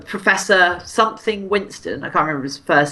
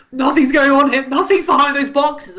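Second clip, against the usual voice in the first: higher-pitched, lightly acted cover-up; mock innocent denial; 8 semitones higher, 3 dB louder than usual. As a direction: play a gorilla badly hiding something. Acting out gorilla hiding a rocket.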